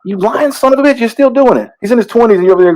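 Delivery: high-pitched accusing outburst; mock indignant scolding. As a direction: scold a lying parent in exaggerated outrage. Mock scolding of lying parents.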